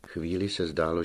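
ordinary delivery

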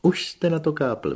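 high, eager cry; excited exclamation. A narrator's reading. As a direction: burst out with delight. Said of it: "vykřikl hrbáč".

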